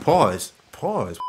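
normal talking voice

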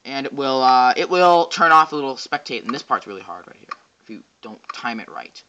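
usual speaking voice

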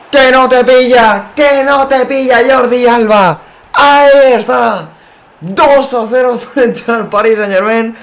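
loud, high, drawn-out goal call; breakneck goal commentary. Real, not acted.

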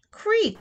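high creak; a high creaky bed noise. A narrator's reading. Floor sound effect.

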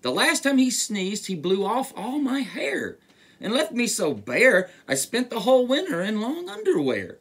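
high-pitched storybook grumble; complaining bear. A narrator's reading. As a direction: protest as a put-upon bear. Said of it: "said the bear".

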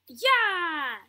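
loud, long whoop; triumphant cheer. Real, not acted. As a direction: cheer it out in victory. Succeeds in the game.

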